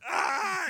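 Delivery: very high loud shriek of fright; startled yell. Retold or imitated. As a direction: shriek as if caught off guard. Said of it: "I was like".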